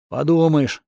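ordinary delivery, nothing marked

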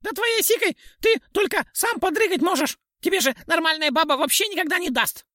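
very high sharp pixie taunt; shrill pixie voice. A narrator's reading. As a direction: jeer up in a tiny piercing voice.

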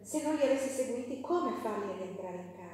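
ordinary delivery

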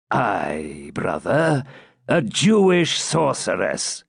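slow, weighty confirmation; stern. A narrator's reading. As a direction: lay down the charge coldly. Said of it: "said the Grand Master sternly".

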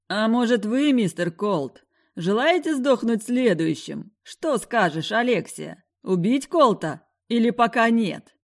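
taunting. Acted. Villain taunting.

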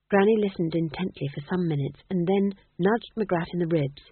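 usual speaking voice

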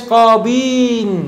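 drawn-out قاضين, qaa-diiii-nn with no u; underlying form. Shown as a demonstration. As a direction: stretch قاضين very long as qaadiii-n. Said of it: "قاضي هي أصلًا إيش؟".

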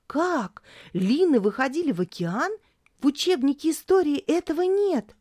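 put-on girl suddenly excited; startled girl. A narrator's reading. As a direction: jump up in surprise. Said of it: "Тори вскинулась".

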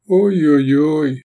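drawn-out woeful oh dear; sad. A narrator's reading. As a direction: lament it slowly with mock concern. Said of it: "грустно сказал чей-то голос".

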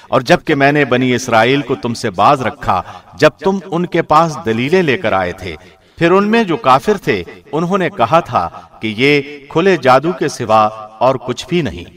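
normal talking voice

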